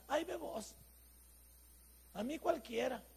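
husband dodging his wife, a mock careless whine; indifferent whine.

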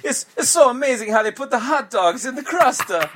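high, hammed-up Italian accent; Italian mama gushing over pizza; jolly Italian pizza chef. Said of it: "Hey, we're in Italy now".

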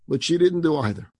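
ordinary delivery

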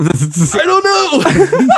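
high, slow, laughing; laughing helplessly. Retold or imitated. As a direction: stretch it out through giggles. Can't answer safe word question.

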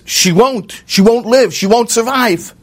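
high, pressing warning; insistent farmer's wife. Retold or imitated. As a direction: she insists the baby will not survive. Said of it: "the farmer's wife says".

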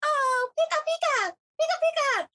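very high squeaky cartoon critter voice; high-pitched cutesy Pika cries; Pikachu impression. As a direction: chirp it like a tiny cute electric mouse. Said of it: "Pikachu was like".

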